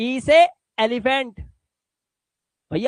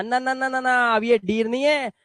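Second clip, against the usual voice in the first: usual speaking voice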